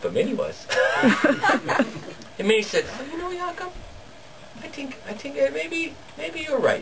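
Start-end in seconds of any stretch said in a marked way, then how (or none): 3.0-6.8 lightly raised, soft and coaxing wife; Minnie's sweet voice. Retold or imitated. Wife coaxing her husband into a day home, soft and higher. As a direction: sweet-talk him into switching chores.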